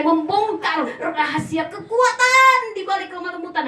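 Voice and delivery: loud, high, swelling proclamation; booming emphasis. Real, not acted. Preacher announces the secret of strength, booming. Preacher booms the key phrase.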